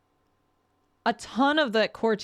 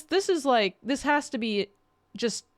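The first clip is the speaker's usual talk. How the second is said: ordinary delivery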